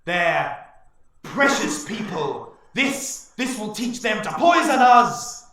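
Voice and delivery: booming high, loud, slow war-chief proclamation; rally the tribe with a roaring speech; triumphant orc warlord bellowing to his warriors; orc chieftain declaring. Acted. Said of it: "the orc chieftain, declaring".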